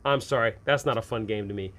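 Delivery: usual speaking voice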